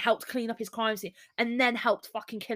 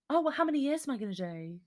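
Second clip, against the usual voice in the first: mock casual; 5 semitones higher, 5 dB quieter, much faster than usual. Mocking her casual question.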